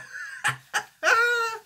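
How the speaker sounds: squeaky burst of laughter; high giggle burst. Real, not acted.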